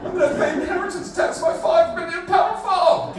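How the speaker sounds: high, loud whining complaint; mock farmer. Comic mocks farmer protesting inheritance tax, whining complaint. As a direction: wail it high like an aggrieved farmer.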